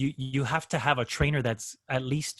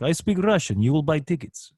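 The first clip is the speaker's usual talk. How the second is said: playful mock Russian accent, a bit louder; 4 dB louder than usual. Agent teasing a Russian caller into buying concert tickets, mock Russian accent. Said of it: "I would start doing their accents".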